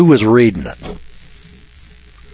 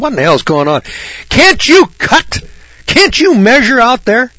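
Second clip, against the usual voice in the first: high, loud yelling down at the crew; yelling in disbelief at a bad cut; retold yelling; 13 semitones higher, 3 dB louder than usual. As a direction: bellow at the workers from above, slowing on the questions. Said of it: "I'm yelling down at these guys".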